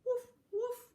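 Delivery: high, loud little woofs; high-pitched playful barking; dog bark. Acted. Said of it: "barked the".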